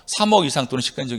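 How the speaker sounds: "삼억 이상 또는 시가 인정" fast and run together; wrong rushed reading. Shown as a demonstration. Teacher mimicking students' wrong reading of the clause, rushed. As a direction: rattle through "삼억 이상 또는 시가 인정" without phrase breaks. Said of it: "이렇게 읽지 말라고".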